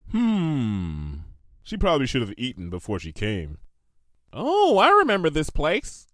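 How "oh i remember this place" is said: higher, slightly louder mare voice remembering; Bon Bon recalling. A narrator's reading. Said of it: "Bon Bon said".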